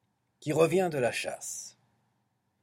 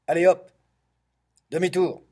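loud, higher shouted order; brisk command; 5 semitones higher, 9 dB louder, faster than usual. Acted. Ogre turning the boat round, loud command. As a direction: call it out sharply and loud.

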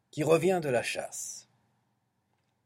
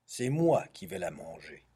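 usual speaking voice

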